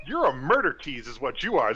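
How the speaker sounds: ordinary talk, nothing marked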